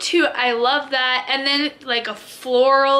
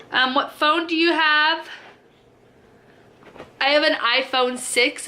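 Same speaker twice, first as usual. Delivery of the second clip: usual speaking voice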